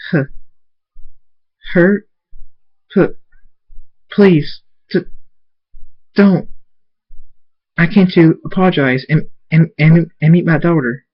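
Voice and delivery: high, stammering, pleading voice; halting plea. A narrator's reading. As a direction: beg haltingly, stumbling over the words. Hurt mare pleads for mercy.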